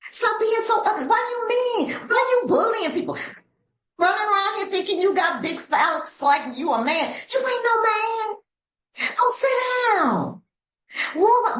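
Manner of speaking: hammed-up high-voiced telling-off; mock scolding. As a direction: scold an angry person in a high, exaggerated voice. Mock scolding of a mad sponsee.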